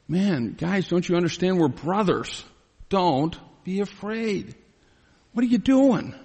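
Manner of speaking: pained, high-pitched brotherly protest; exasperated pleading. Acted. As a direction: plead with them in disbelief, without shouting. Preacher voices Joseph pleading with brothers.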